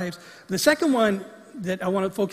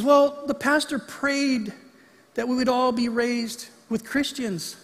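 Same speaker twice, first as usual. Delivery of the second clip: put-on higher, slow, whimpering child voice; crying little kid; 5 semitones higher, slower than usual. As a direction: plead it like a tearful small boy. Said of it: "And he says".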